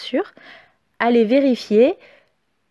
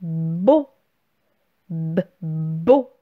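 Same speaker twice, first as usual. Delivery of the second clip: syllabe détachée, nettement plus grave et douce; dictée articulée; 6 semitones lower, much slower than usual. Shown as a demonstration. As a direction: dicter lentement à l'élève. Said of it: "nous avons la syllabe". (voix de dictée grave et posée)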